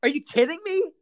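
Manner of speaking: high, disbelieving exclamation; incredulous outburst. Retold or imitated. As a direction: act out the shock of hearing it. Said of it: "I was like".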